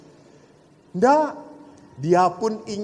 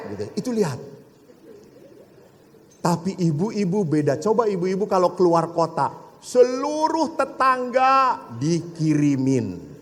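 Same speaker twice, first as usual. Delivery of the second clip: normal talking voice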